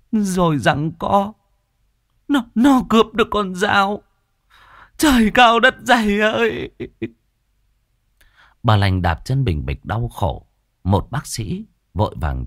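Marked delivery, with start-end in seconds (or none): 0.1-6.7 wailing lament. Acted. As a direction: lament to the heavens in anguish. Grieving mother recounting the murder. (bà mẹ than khóc kêu trời)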